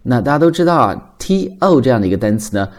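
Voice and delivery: ordinary delivery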